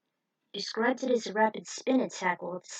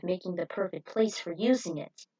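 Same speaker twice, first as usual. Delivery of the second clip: usual speaking voice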